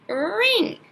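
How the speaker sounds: teacher comparing first sounds; comparison demo. Shown as a demonstration. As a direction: draw out the r of "ring.". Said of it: "What about sandwich".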